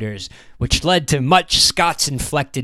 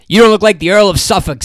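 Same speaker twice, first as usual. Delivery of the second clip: higher, louder and fast, brightly; bright retort; 8 semitones higher, 9 dB louder, faster than usual. A narrator's reading. Theater owner brightly teasing Wild Jack, quick and loud. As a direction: toss it back brightly, quick and cheery. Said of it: "said Smith brightly".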